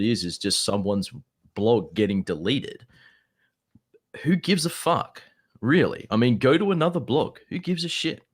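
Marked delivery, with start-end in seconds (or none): 4.2-8.2 exasperated. Real, not acted. Exasperated dismissal in debate.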